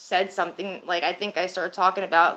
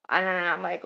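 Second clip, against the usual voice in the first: normal talking voice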